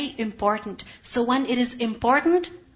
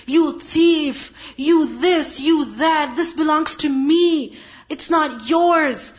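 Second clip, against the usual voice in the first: louder, higher mock tantrum, slowed at the end; 3 semitones higher, 4 dB louder, slower than usual. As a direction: rage at mom like a spoiled teen. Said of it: "the daughter is throwing a tantrum".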